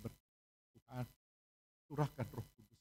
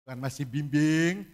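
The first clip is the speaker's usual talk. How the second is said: preaching at full force; 13 dB louder than usual. Acted. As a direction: stretch each phrase out to the crowd. Preacher exhorts, slow and loud.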